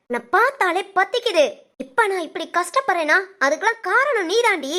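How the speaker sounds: normal talking voice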